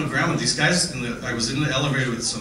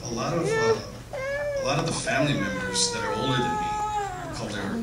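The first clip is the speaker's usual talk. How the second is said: choked up, fighting tears; faltering tender eulogy. Real, not acted. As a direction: voice breaking with tears. Crying while recalling grandma.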